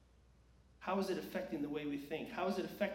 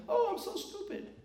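put-on much higher, whiny child voice; imitating a kid. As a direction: mimic a kid sulking on the playground. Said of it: "hearing a kid somewhere on the playground".

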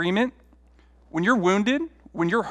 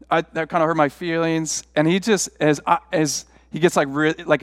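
normal talking voice

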